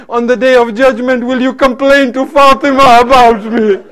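fervent majlis oratory with pleading dialogue; impassioned preaching. Acted. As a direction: preach the tale as an emotional lament.

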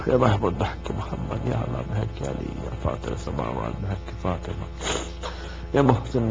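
soft, fast prayer recitation; closing supplication. Real, not acted. Preacher closing the gathering with prayer, soft and fast. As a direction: murmur the closing dua quickly.

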